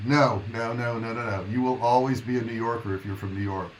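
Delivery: ordinary talk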